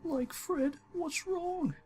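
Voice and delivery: very high, anxious cartoon voice; high, fretful Shaggy voice; worried Shaggy.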